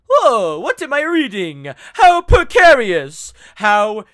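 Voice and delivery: booming, high, theatrical exclamations; mocking Dragon VTuber impression. Mocking a grown man's dragon act, loud high impression. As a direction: mimic a pompous dragon streamer, loud and stretched. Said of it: "and he's going like".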